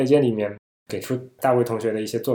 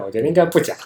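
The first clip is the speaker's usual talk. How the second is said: normal talking voice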